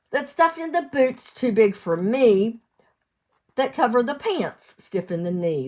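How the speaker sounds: sing-song storybook read; lilting picture-book reading; reading to children. Acted. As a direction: read the rhyme out to little ones. Cumulative rhyme read aloud.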